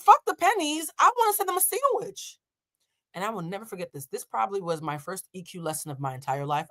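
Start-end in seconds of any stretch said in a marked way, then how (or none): 0.0-2.4 put-on pitched way up like a bratty kid; squeaky child insisting; mock child whine; high kid voice. Kid demanding sandwiches again.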